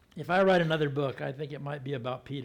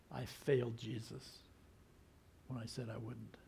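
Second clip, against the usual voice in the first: grieving regret; 4 semitones lower, 12 dB quieter than usual. Retold or imitated. Peter's regret voiced.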